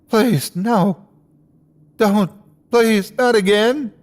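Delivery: high anguished begging; anguished high pleading; desperate pleading. A narrator's reading. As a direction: beg it not to happen. Parents crushed, pleading.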